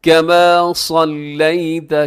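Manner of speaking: very slow chant with long held vowels; measured melodic Arabic recitation; darood recitation. Shown as a demonstration. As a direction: sustain each long syllable for students to follow. Teacher recites darood to demonstrate.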